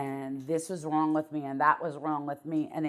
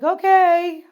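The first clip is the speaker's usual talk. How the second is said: loud, much higher squeaky okay; chirpy okay; 16 dB louder than usual. Retold or imitated. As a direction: pop it out high and bright. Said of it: "I was like".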